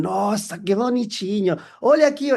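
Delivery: excited customer calling. Retold or imitated. Customers calling him over.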